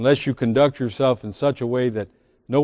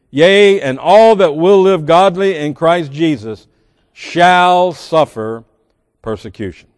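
louder, solemn Bible reading; scripture reading; 4 semitones higher, 7 dB louder than usual. Acted. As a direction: read the verses with ringing weight. Reads scripture aloud.

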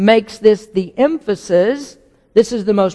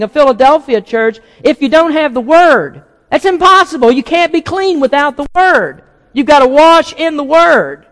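loud, high, urgent pulpit climax; full-force preaching; 6 semitones higher, 4 dB louder than usual. Acted. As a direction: hammer each question at the congregation.

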